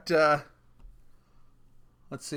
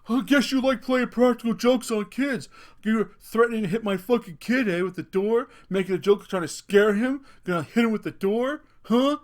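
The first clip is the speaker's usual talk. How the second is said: raised, higher accusing rant; imitated angry yelling; 5 semitones higher, 6 dB louder than usual. Angry father accusing a stranger over his kid, imitated yelling. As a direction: lean in and berate, pitched up. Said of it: "leans in and starts yelling".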